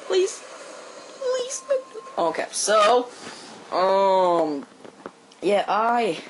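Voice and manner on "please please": high, whiny please; pleading. Real, not acted. Gamer begging the game for the kill cam replay, pleading.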